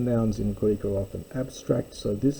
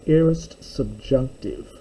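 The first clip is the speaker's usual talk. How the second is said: ordinary talk, nothing marked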